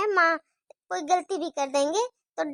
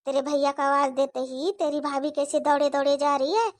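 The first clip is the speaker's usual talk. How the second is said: ordinary delivery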